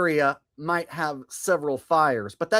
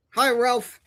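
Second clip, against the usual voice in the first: greeting the dog; 9 semitones higher than usual. Real, not acted.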